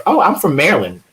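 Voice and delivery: exaggerated chirpy quote of a non-local; mocking outsiders. Baltimore native imitating people who name the state, mocking lilt.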